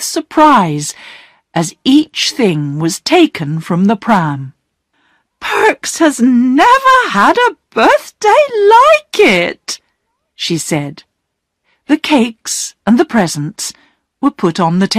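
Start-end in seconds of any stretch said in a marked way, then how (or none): 5.4-9.8 much higher, delighted disbelief; amazed wife. Acted. Mrs Perks sees the presents, higher amazed voice. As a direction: gasp it out in wonder.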